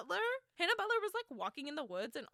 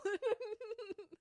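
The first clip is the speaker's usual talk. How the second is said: high laugh; 13 semitones higher than usual. Real, not acted. Laughing at the bloodhound story.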